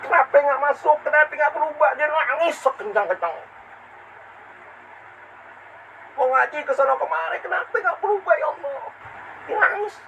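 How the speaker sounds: high, wailing preacher's lament; impassioned preaching. Acted. Preacher telling of a weeping seeker, high impassioned lament. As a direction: cry out each question in anguish.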